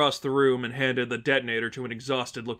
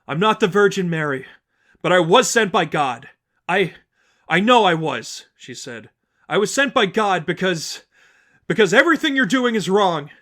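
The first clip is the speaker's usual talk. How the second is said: put-on higher, louder young woman pleading firmly; Stephanie, urgent denial; 6 semitones higher, 8 dB louder than usual. Stephanie denying to the congregation she is Mary, urgent. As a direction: insist you're no saint.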